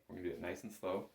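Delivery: usual speaking voice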